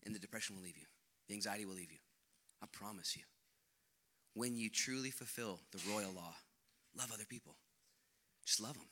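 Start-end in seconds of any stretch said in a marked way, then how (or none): none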